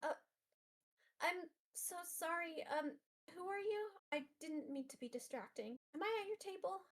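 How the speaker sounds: put-on timid girl caught upset, haltingly; small, high, sheepish voice; apologetic crying girl. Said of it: "she's gonna look up at you".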